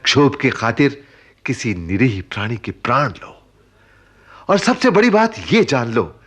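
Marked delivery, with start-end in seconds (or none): 4.5-6.2 stern emphasis. Acted. Uncle lecturing sternly.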